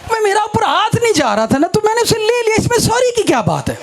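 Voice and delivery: high-pitched, indignant self-defence; acted child's protest. Younger daughter defending herself to papa, high protesting voice.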